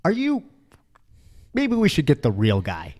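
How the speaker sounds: high, loud, disbelieving; patient doubting the intern's blunt advice, incredulous. Retold or imitated. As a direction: ask for the real doctor in mock alarm. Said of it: "And I was like".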